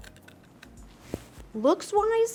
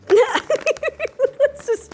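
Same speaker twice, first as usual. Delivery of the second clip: laughing; 13 semitones higher, 6 dB louder than usual. Real, not acted. Laughing before tasting.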